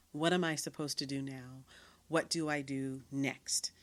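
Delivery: ordinary talk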